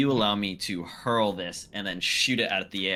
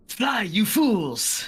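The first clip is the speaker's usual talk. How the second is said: high, drawn-out battle yell; loud, high-pitched warning cry; 13 semitones higher, 5 dB louder, much slower than usual. Acted. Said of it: "Jacquette's just yelling out".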